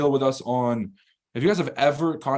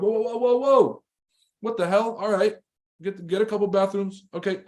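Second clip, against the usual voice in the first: high, startled whoa's settling down; mock alarm; 6 semitones higher than usual. Reacting to an outrageous demand: high mock alarm. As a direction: act out being taken aback, then giving in. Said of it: "You're like".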